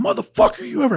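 sudden high furious yell; hammed-up retold roar; angry yell. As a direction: burst out yelling at him. Charging in, confronting the guy.

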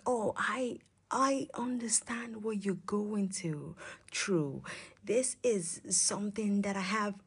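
soft hushed sympathetic tone; hushed comfort. Retold or imitated. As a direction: whisper it gently as if consoling. Retold comforting quote, whispered.

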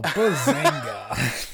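slow, drawn-out sitcom catchphrase; bazinga catchphrase. Retold or imitated. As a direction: stretch bazinga out like a sitcom nerd. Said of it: "There's a bazinga somewhere".

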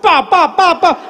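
comic rat-a-tat mouth noise; machine-gun imitation. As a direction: imitate gunfire with hard, separate syllables. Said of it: "I heard like a machine gun".

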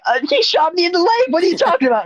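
high, fast mock squeal; indignant victim. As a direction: protest frantically in a squeaky put-on voice. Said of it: "the guy's like".

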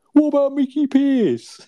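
Albert slyly naming Mickey Pearce, high and drawn out; sly suggestion. Retold or imitated. Acted sitcom dialogue.

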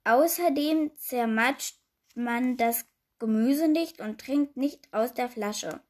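plain reading voice

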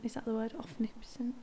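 unsure whisper to herself; softly whispered question. Real, not acted. Unsure of a word.

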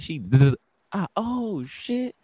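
buzzing zap, then a high yelp of pain; mock zap and high pained yelp; retold taser shock. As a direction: imitate the taser and your own shocked cry. Getting tased in the car.

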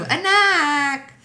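high, loud mock Filipino-mom call; exaggerated Filipino mother's accent. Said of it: "Do your, do your mom's accent".

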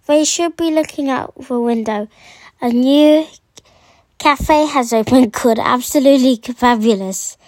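loud, projected story reading; reading aloud. Acted. Child reading a book extract for listeners, loud read-aloud. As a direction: read the passage out loudly.

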